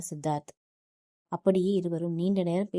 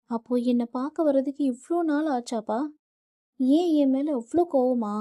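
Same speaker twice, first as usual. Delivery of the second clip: hurt, tearful asking; 6 semitones higher, 4 dB louder than usual. A narrator's reading. Daughter's hurt questions to father.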